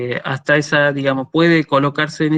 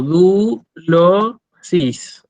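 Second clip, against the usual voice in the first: "du, lo, sis" as three separate, drawn-out syllables; teacher sounding out "du, lo, sis" with pauses; 2 semitones higher, much slower than usual. Shown as a demonstration. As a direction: break it up: du... lo... sis. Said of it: "Si yo separo en sílabas".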